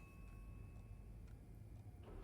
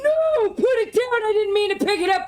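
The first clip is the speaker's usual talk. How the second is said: panicked yell; 7 semitones higher than usual. Real, not acted. Player yelling at the character to drop the ice pick, high panicked shout.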